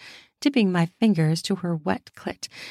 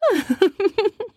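high playful giggle; girlish giggle; 13 semitones higher than usual. A narrator's reading. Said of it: "she giggled".

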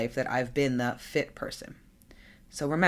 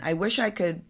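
usual speaking voice

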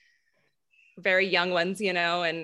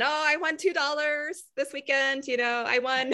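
bragging mom, pitched higher; 6 semitones higher than usual. Retold or imitated. Daughter imitating mom's weekend golf bets, bragging. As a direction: crow about a little win in a higher voice. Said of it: "She's always bragging about every weekend".

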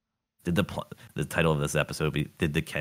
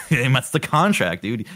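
laughing; 8 semitones higher, 5 dB louder, slower than usual. Real, not acted. Laughing at the note.

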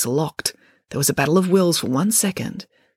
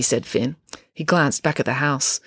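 ordinary delivery

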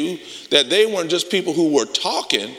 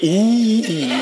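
put-on kids egging on; 6 semitones higher than usual. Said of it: "they went".

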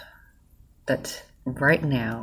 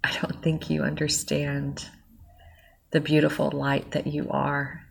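getting emotional; 3 semitones higher than usual. Real, not acted. Moved to tears while thanking the listener, emotional. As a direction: let the gratitude overwhelm you, voice lifting as you tear up. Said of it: "I'm getting emotional".

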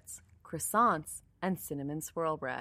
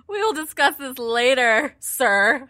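loud, high mock sternness; mock stern warning; 7 semitones higher, 11 dB louder than usual. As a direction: threaten playfully, hammed-up. Mock threat after laughing.

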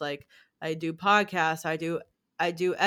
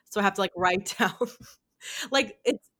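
normal talking voice

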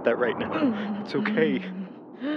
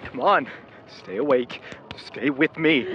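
ordinary talk, nothing marked

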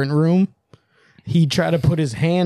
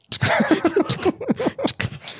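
unvoiced high giggle; airy giggle pitched way up; breathy high laugh; 3 dB quieter than usual. Imitating the pilot's helpless moment, airy high giggle.

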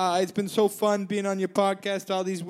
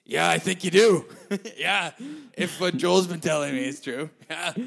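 much lower, gruff put-on man's voice; Rupert voice; 8 semitones lower than usual. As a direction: drop low and play Rupert on the phone. [name] holding Rupert voice.

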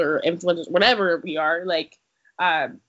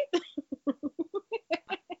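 high, softer trailing giggle; laughing; 9 semitones higher, 9 dB quieter than usual. Real, not acted. Co-host after her Krispy Kreme comeback, giggling. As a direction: giggle helplessly at your own joke.

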